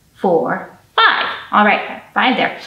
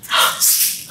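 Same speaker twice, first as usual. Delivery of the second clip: big hammed-up gasp; mock gasp; 4 dB quieter than usual. Storyteller gasping in surprise before the shaky egg. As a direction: gasp for the children.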